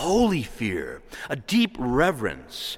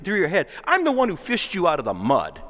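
God scolding his people, raised and pleading; voice of the Lord, stern; 4 semitones higher than usual. Acted. Preacher voicing God to the congregation, stern and raised. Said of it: "The Lord says".

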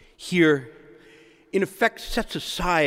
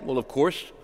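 usual speaking voice

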